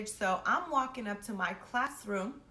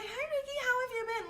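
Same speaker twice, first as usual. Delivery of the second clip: much higher, sugary mock-friendly voice; fake sweet greeting. Mocking the girl who'd pretend to be friendly, fake sweet and high. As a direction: play a two-faced girl acting like nothing happened.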